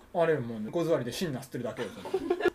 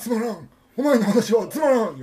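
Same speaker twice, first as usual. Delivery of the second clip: higher, louder, slow gruff grumble; a character change mid-conversation, louder and higher; sudden gruff character; 7 semitones higher, 10 dB louder than usual. Acted. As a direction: switch into a blunt old grouch. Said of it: "人が変わっちゃってますよ".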